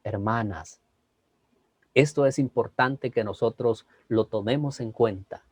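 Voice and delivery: soft, low, measured preaching; low and quiet, with weighty pauses; change of intonation. Shown as a demonstration. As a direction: drop to a hushed, grave appeal. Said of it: "vieron que yo hice un cambio".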